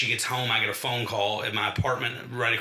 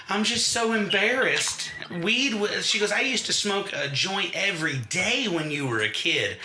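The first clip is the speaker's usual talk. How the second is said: embarrassed mother; 8 semitones higher than usual. Retold or imitated. Mother confessing to her son, high embarrassed voice. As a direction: play a sheepish mother in a raised voice.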